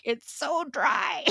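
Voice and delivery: drawn-out playful lament; parched fern. Pretend. Host as her dried-out fern in winter, mock-pitiful. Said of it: "I feel like that fern".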